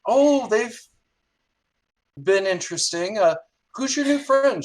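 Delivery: high, lively reply with loud bursts; chatty and pitched right up; effusive hostess. Acted. Big Sally meeting a new friend, effusive.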